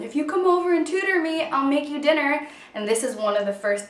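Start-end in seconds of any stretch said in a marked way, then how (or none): 0.0-2.4 coaxing offer. Retold or imitated. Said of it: "I used to kind of tell him".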